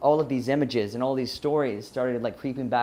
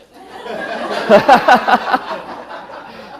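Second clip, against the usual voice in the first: airy high-pitched laugh bursting out; high breathless laugh; 11 semitones higher, 9 dB louder than usual. Real, not acted. Speaker cracks up recalling walking over hot coals, airy laugh. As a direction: let a big breathless laugh escape.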